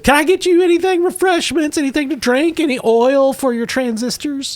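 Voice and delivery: very high hostess voice; Gamorrean woman. Acted. Hostess offering her guests refreshments, high character voice. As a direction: offer drinks in a high-pitched housewife voice. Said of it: "So she says".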